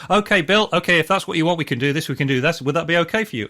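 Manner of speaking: match the pushy client's energy and pace. Shown as a demonstration. Salesman matching a demanding client, loud and brisk. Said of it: "You talk in the same tone of voice, you talk at the same kind of velocity".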